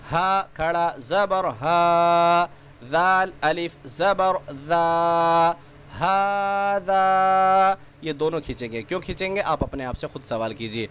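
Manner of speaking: slow, drawn-out chanted letter recitation; sing-song spelling drill. Shown as a demonstration. Teacher spelling letters aloud for children, slow sing-song chant. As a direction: chant each letter and its vowel for young learners.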